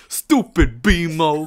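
loud, slow Boston-New York tough-guy insult; Boston-New York thug accent. Retold or imitated. Mimicking friend scolding her cat, loud Boston-New York thug accent. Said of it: "like, Boston slash New York type, like, thug accent".

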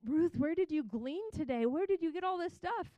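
high, rising mock astonishment; amazed questioning. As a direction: imitate an astonished mother-in-law, pitch climbing. Voices Naomi's amazed questions to Ruth.

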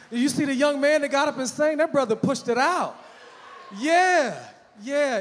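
loud, high, hyped-up praise; excited shout. Real, not acted. Preacher hyping the singing youth.

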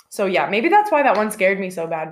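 ordinary delivery

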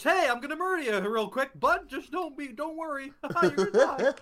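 high, loud comic killer voice; cheery murderer. Acted. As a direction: threaten in a loud, squeaky put-on voice. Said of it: "They could sound like this".

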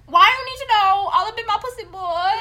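high, loud put-on voice for the Vine line; a loud, high mock voice hammering out a Vine catchphrase. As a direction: squawk it like a sassy Vine clip. Quoting a Vine in a voice.

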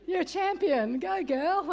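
high, loud cheer through laughter; laughing cheer. Retold or imitated. Speaker cheering on the new mother, laughing. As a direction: cheer her on while cracking up.

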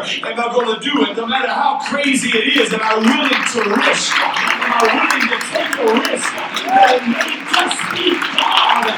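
loud, high revival preaching; full-force preaching. Real, not acted. Preacher urges congregation to take a risk, full-force preaching. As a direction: drive it hard, shouting to the congregation.

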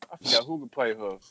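ordinary delivery

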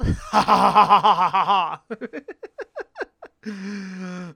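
big open cackling laugh; loud real cackle. Real, not acted. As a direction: burst out laughing. Big laugh after title.

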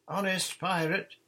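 high, loud promise; shouted. A narrator's reading. Nancy shouting her promise to John, loud and high. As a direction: yell the promise down. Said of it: "shouted Nancy".

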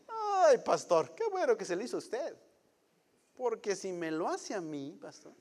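the brother's voice. Retold or imitated. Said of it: "entonces dice el brother".

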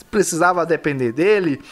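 ordinary delivery

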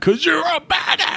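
high-pitched hype-man yell; playful, high-voiced cheer; mock hype shout. Cohost hypes up the storyteller.

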